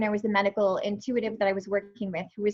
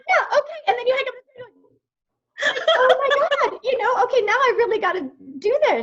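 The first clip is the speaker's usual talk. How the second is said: mock panic; 12 semitones higher, 6 dB louder than usual. Acting out panicked client call.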